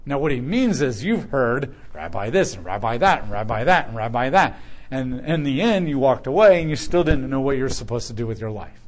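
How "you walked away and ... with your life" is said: ordinary delivery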